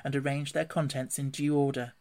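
usual speaking voice

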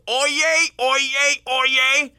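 loud, high mock town crier call; town crier shout. Host mocks the old town crier, shouting loud. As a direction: bellow it like a medieval town crier. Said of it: "Those who yelled".

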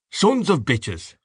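high, drawn-out curse; slow, bitter oath; angry cry. A narrator's reading. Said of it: "cried Mister Dedalus".

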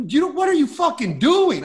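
high, indignant bark; mock an outraged cabbie; cab driver snapping. Cab driver scolding a kid in the front seat, high indignant bark.

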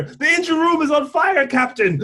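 put-on a Scottish accent that slides toward Jamaican; bad Scottish accent. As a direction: shipboard engineer reporting to the captain, in a mock Scottish accent. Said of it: "Your, your Scottish sounds Jamaican".

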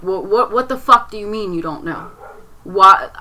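furious and desperate for answers; angry demand. Retold or imitated. Daughter demanding answers from her stepdad, angry. Confronting stepdad about the death.

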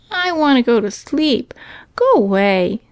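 put-on high, grumpy little-boy voice; sleepy brother. A narrator's reading. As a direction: complain like a child wanting sleep. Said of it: "said the brother, lying down again".

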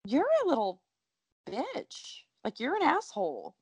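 high, heated put-down at a character; mock outraged retort. Fan venting at a character on screen, high mock outrage. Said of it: "I'm like".

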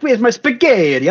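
put-on high, over-the-top Italian accent. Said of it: "if you're doing an Italian accent".

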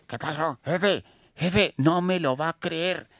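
high, urgent officer calling his boss; traffic officer on radio. Acted. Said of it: "prendió el radioteléfono el, el agente de tránsito".

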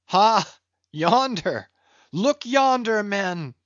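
high, loud calls of alarm; foreboding cry. A narrator's reading. Sailor calls to his mates in the ship's wake, foreboding cry. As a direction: call out a warning, pitch leaping up. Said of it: "cried a foreboding voice in her wake".

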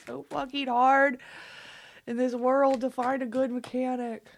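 giddy gush. Real, not acted. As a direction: praise the mechanic to viewers, overjoyed. Gushing over great mechanic.

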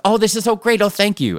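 overjoyed as if handed a gift; gleeful Michael. Retold or imitated. Michael delighted by Eleanor's speech, high gushing joy. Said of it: "the way Ted played it".

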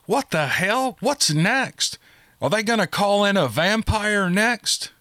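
high-pitched exasperated outburst, a mild shout. A narrator's reading. Margolin ranting about what creature comes next, mildly shouted. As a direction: rant in disbelief without much volume. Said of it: "shouted Margolin".

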